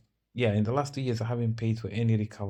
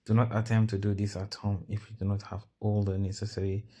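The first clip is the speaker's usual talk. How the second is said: ordinary talk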